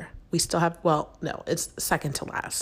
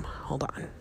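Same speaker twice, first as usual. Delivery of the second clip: whispering; 4 semitones lower, 7 dB quieter than usual. Real, not acted. Sneaking into another room.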